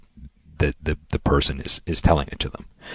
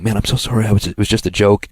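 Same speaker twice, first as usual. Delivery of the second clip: higher, hurried mock apology; imitated backpedaling; 5 semitones higher, 4 dB louder than usual. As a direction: mimic someone caught out and backing off.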